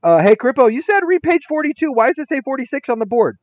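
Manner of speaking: playful mock sixth grader; eager student. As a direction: call out the teacher's mistake brightly. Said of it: "Hand goes up".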